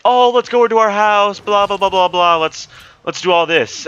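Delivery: loud, high mocking group chatter; mock excited chatter. Squad making plans on the bus, mock excited.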